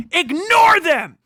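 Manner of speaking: loud, slow shout of ignore them; furious yelled command. Real, not acted. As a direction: bark the order at the crowd. Ranting command, peaks.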